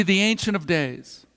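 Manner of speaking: usual speaking voice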